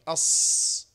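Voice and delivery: "أص" with a long whistling ص; bird-like whistle. Shown as a demonstration. Teacher compares safir to a bird, whistled "أص". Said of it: "صوت مثل صوت الطائر".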